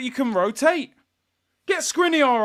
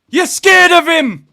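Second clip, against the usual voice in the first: angry taunt; 7 semitones higher, 10 dB louder than usual. Real, not acted. Repeats the taunt.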